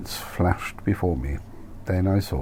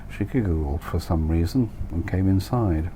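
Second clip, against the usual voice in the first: ordinary delivery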